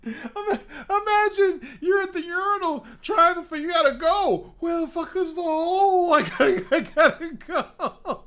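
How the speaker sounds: high, loud laughing voice. Real, not acted. Comic imagining the urinal, laughing hard. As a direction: gasp it out between laughs.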